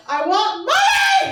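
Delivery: loud, high, slowed laughing cry; loud mock demand. Comic riffing on [name] lyrics, a loud laughing mock demand. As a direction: yell it like a greedy lyric.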